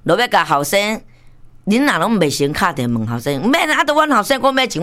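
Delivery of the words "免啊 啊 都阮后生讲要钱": high-pitched brush-off, acted out; mock dismissive retort. Parent brushing off checking with the son, mock dismissive. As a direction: wave the question away in a raised pitch.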